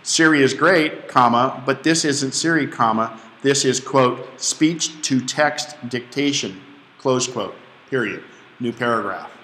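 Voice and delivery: dictation voice, punctuation spoken aloud; dictating with punctuation. Shown as a demonstration. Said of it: "I spoke my punctuation".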